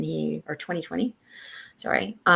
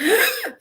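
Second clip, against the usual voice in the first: sudden high sharp intake of breath; sharp anxious gasp; 7 dB louder than usual. Retold or imitated. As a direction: gasp as panic grips the chest. Recalling chest tightness panic.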